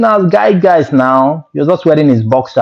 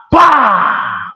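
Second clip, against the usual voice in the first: ordinary talk, nothing marked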